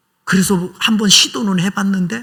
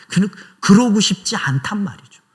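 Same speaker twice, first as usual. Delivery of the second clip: ordinary talk, nothing marked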